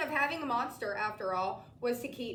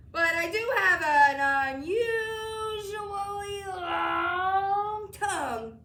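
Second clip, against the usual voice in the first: very slow, higher, louder drawn-out menace; trying to sound scary; 7 semitones higher, 7 dB louder, much slower than usual. A narrator's reading. Mac tries to scare the boy, slow mock menace. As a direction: stretch long out to spook the kid. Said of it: "trying to sound terrifying".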